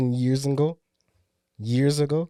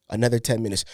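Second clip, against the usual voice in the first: usual speaking voice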